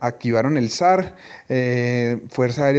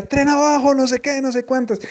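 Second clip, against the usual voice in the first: captain in background; 12 semitones higher than usual. Retold or imitated. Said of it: "se escuchaba el comandante que decía".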